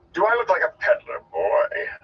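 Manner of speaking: indignant, high-starting grumble; theatrical grumpy retort; grumbling. Acted. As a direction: complain testily to the lad. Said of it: "the Gleeman grumbled".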